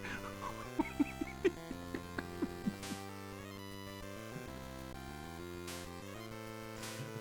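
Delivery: long, wheezing, dwindling laughter; hysterical laughing fit. Real, not acted. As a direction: laugh yourself out of breath. Cracking up after failure.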